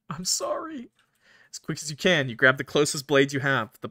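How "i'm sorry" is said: very high, cracking apology; anguished apology. Acted. Player apologizing to Button before the kill, very high and anguished. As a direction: let it break upward in grief.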